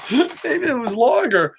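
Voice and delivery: said through real laughter; amused, laughing while reading. Real, not acted. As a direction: giggle the words out. Reads the line, then repeats laughing.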